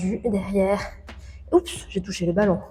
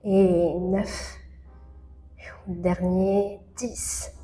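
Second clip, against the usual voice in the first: whispered counting; 8 dB quieter, much slower than usual. Real, not acted. Whispered counts mid-exercise.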